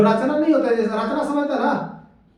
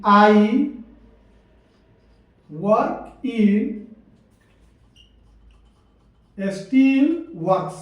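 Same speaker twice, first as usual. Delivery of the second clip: word by word with long pauses; slow dictation; much slower than usual. Shown as a demonstration. Teacher writing an example for students, slow dictation.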